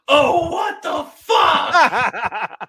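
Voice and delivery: slow, loud, high mock groan of disgust; retold outburst. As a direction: drag it out like a fed-up moviegoer. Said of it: "someone audibly behind me said".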